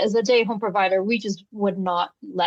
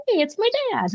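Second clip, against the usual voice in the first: put-on child's excited voice; 12 semitones higher than usual. Toddler recognizing his dad.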